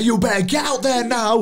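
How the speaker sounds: high, urgent corner yell; booming defiant gladiator. Retold or imitated. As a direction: order him out, high and pushy. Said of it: "You're giving a gladiator speech".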